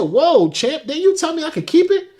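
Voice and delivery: high-pitched taken-aback protest; incredulous retort. Retold or imitated. Acted retort to debt demand.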